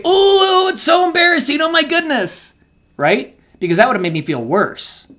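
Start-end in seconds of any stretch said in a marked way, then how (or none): 0.0-2.5 exaggerated gasp. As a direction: overdo the shocked sympathy. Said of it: "She didn't say".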